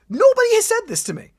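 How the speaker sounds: nobody told me, really pushing it; outraged disbelief. Real, not acted.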